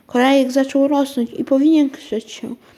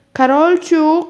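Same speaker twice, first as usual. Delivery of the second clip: higher, louder call from another room; mother calling; 5 semitones higher, 5 dB louder, slower than usual. Acted. Mother calls Karolcia out of the bathroom, loud call. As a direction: call her daughter from the next room. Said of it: "rozległ się nagle z pokoju głos mamy".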